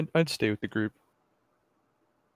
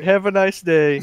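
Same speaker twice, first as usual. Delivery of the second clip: awkward loud goodbye; 7 semitones higher, 10 dB louder, slower than usual. Acted. Player awkwardly exits.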